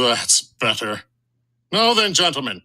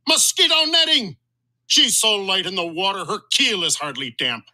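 higher, outraged sea-captain bluster; captain's indignant rant; 6 semitones higher than usual. Acted. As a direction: scoff at the rival's cargo. Captain rails about Sea Raven.

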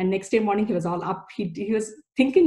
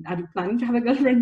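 teasing; 3 semitones higher, faster than usual. Retold or imitated. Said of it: "joke about this all the time".

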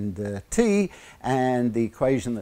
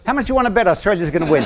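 teasing challenge; 6 semitones higher, 5 dB louder, much faster than usual. Real, not acted. Teasing Indian students about cricket.

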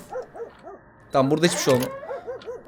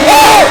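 high, loud yelp of fright; shocked scream; 12 semitones higher, 7 dB louder than usual. Real, not acted. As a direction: shriek at the jump scare. Another sudden scare. (ani korkuyla haykırış)

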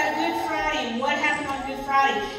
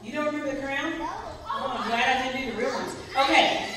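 ordinary talk, nothing marked

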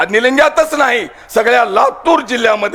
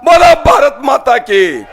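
slogan shout; 5 semitones higher, slower than usual. Real, not acted. Politician urging his sisters to chant, slow rousing shout. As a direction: throw the slogan to the crowd twice. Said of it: "वरी करून द्या घोषणा".

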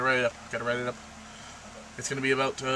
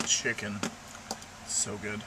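ordinary talk, nothing marked

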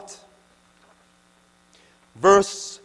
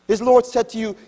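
usual speaking voice

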